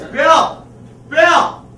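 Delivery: sudden high scream of a name; Patty LuPone. Acted. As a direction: yell sharply to get someone's attention. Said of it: "she suddenly screams".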